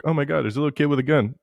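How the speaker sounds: quick, high imitation of a shocked bystander; hammed-up rushed panic; mock alarm. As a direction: blurt it out as a pretend worried onlooker. Acting out an alarmed onlooker.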